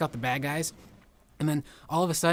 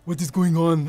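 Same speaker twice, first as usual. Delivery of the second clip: loud, lightly voiced action-star bark; a hint of Arnold, much louder; Arnold impression; 2 semitones higher, 9 dB louder than usual. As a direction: boom it out like a muscle hero. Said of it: "and Arnold's like".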